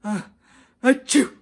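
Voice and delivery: big loud sneeze ah achoo; loud hammed-up elephant sneeze. A narrator's reading. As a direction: sneeze it out for real. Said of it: "said with a sneeze".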